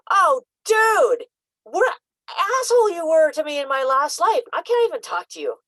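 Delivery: put-on high, loud exasperated blurt at an old foe; hammed-up fury at someone from a past life; mock outraged rant. Remembering past lives, blurting outrage at an old enemy, hammed up. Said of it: "I'd be like".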